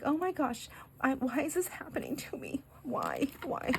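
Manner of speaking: high, soft wail sinking to whisper; mock despair. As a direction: retell it as a hushed, helpless lament. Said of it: "I'm, like, almost in tears".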